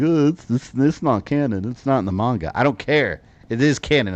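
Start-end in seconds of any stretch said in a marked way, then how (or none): 0.0-2.5 mocking whiny fan. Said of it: "People are like".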